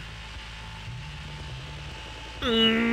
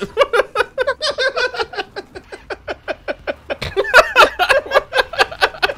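long high helpless laughter; laughing fit; 13 semitones higher than usual. Real, not acted. Gamer cracking up over the joke, long laugh.

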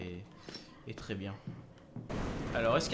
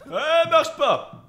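loud, high, drawn-out yell; shouted protest; 9 semitones higher, 12 dB louder, much slower than usual. Real, not acted. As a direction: shout a long protest at the game. Protesting during game. (protestation criée)

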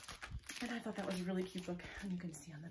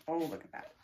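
ordinary delivery

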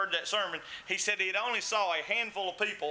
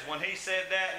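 usual speaking voice

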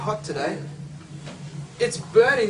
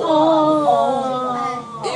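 delighted aww; 6 semitones higher, 5 dB louder than usual. Acted. Monster gets seven-scoop cone.

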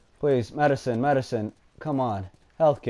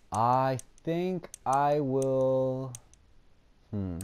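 ordinary delivery

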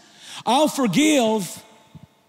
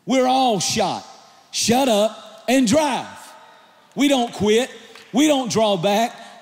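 slow emphatic preaching. Real, not acted.

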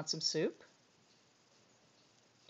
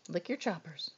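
softer, lower coaxing to the dog; 3 semitones lower, 8 dB quieter than usual. Real, not acted. Owner coaxing her dog after a treat, soft and low. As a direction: murmur it gently to the pet.

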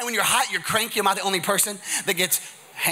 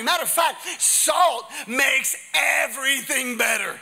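high-pitched, punchy sermon delivery; impassioned preaching; 8 semitones higher than usual. Real, not acted. Preacher telling church they are the salt, impassioned preaching. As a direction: preach it with drive, stretching key words.

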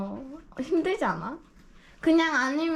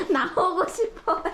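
slow, high words drawn out through laughter; really laughing as you speak; 5 semitones higher, slower than usual. Real, not acted. Amused at the dog in carrier. (웃으면서 높고 길게 늘여서)

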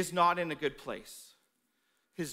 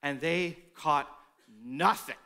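nothing said slow and low with weight; the preacher stressing that they caught nothing, slow and low; 6 semitones lower, slower than usual. Shown as a demonstration. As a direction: lean on the word nothing. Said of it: "the word nothing is emphasized".

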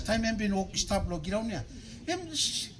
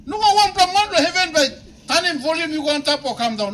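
loud preacher's cadence; full-force preaching; 7 semitones higher, 4 dB louder than usual. Acted. Pastor warning about hell, loud preaching. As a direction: build the warning up strongly.

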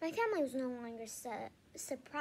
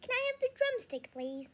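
put-on high, pleading child voice; 12 semitones higher, 5 dB louder than usual. A narrator's reading. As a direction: ask sweetly for a treat. Boy begging for drumstick.

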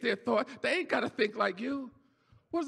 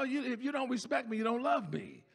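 ordinary talk, nothing marked

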